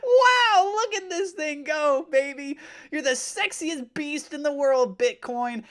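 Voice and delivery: very high, loud, gushing squeal; over-the-top adoration. Pretend. Host flattering Bitcoin like a sweetheart, hammed-up gushing. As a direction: gush in hammed-up, high-pitched awe.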